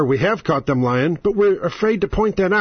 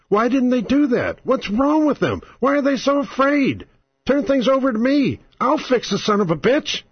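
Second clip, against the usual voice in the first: worked-up rant; 7 semitones higher than usual. Real, not acted. Host ranting at the Democrats.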